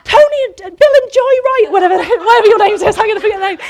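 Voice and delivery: hammed-up retelling of a frantic, laughing outburst; mad woman. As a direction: gush at them like a mad woman. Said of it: "I jumped on them like a mad woman".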